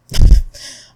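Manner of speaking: loud burst of laughter; short laugh. Real, not acted. Laughing at the bad movie.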